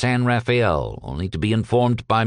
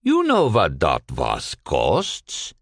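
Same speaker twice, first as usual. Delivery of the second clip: put-on lower, slow, sugary Yiddish-accented shopkeeper; syrupy; 5 semitones lower, much slower than usual. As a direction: drip honey onto the price question, low and slow. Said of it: "Cohn's voice dripped syrup".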